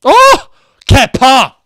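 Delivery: loud, high burst of celebration; real shout of delight; excited shout. Real, not acted. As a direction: cheer the keeper's name out loud. Celebrating the goalkeeper's save live.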